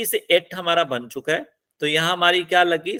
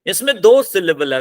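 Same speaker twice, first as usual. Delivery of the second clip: ordinary talk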